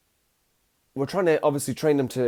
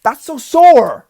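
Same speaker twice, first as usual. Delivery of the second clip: much higher, much louder, slow pained yelling; 12 semitones higher, 14 dB louder, much slower than usual. Retold or imitated. Owner yells at the biting puppy, high and loud. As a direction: cry out as the teeth sink in. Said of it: "I'm like".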